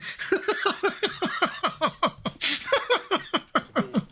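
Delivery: squealing laugh, high and long; high burst of laughter. Real, not acted. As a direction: break into a high cackle. Streamer laughs at own bit.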